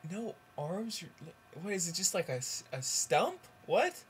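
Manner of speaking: acted shock rising to a high "what"; mock disbelief. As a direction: play a mother stammering in disbelief. Mother reacts to worse news.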